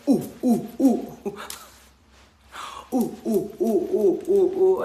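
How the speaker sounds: high, loud chanted ooh ooh; imitated ooh chant. Imitating the group, loud high ooh chant. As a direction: chant the oohs like the crowd did. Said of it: "everybody stands up and they're like".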